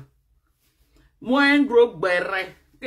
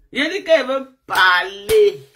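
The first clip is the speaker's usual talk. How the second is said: emphatic call; 3 semitones higher, 8 dB louder than usual. Real, not acted. Insiste auprès du public.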